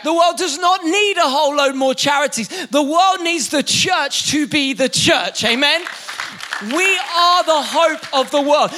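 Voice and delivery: ordinary talk, nothing marked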